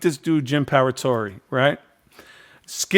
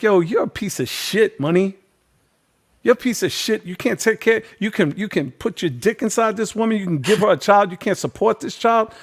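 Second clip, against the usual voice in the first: raised, heated telling-off, pitch up; angry rant; 6 semitones higher than usual. Retold or imitated. Host chewing out a deadbeat dad on stage, heated. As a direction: tear into him like a furious host.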